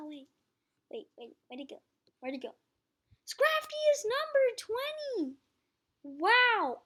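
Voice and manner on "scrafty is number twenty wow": high amazed exclamation; shocked. Real, not acted. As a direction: blurt it out in disbelief. Amazed at ranking.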